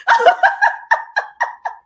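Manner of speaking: squealing laugh, high and loud; delighted high laugh; high burst of laughter. Real, not acted. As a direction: laugh at yourself going off track. Laughs at own digression.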